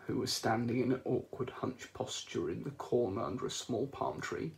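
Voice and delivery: usual speaking voice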